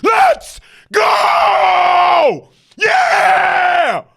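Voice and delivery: loud high hype shout; pumped-up fan shout. Real, not acted. Fan hype chant intro.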